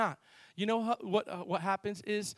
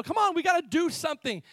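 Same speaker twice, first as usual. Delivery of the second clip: higher, louder plea to act; retold exasperated urging; 9 semitones higher, 5 dB louder than usual. Friend urges the laughing car owner to act, retold exasperation. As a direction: press him impatiently to act.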